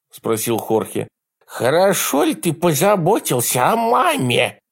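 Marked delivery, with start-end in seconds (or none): 1.5-4.6 put-on high, lisping, girlish man's voice; effeminate lisp. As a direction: repeat it, lisping and pitched up. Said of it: "женоподобный шепелявый голос".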